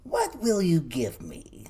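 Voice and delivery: slow, wheedling creature voice; little man voice. A narrator's reading. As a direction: bargain slowly like a crafty gnome. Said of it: "said the little man".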